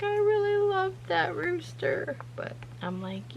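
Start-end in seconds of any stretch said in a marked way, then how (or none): 0.0-2.1 much higher, mock-upset little girl voice; a hint of a sad daughter's lament; imitating upset daughter. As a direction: play the heartbroken kid, lightly. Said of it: "Journey was very, very upset".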